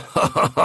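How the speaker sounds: a scornful laugh over the speaker; mocking breathy laugh. Attacker jeering at the crew, breathy amplified laugh. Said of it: "Słyszeli ten sam wzmocniony głos".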